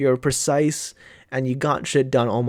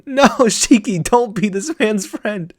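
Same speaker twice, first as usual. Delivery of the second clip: louder and higher, pleading through laughter; laughing plea; 7 semitones higher, 6 dB louder, slower than usual. Real, not acted. Viewer begging Shiki not to befriend the villain, laughing. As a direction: beg him not to do it.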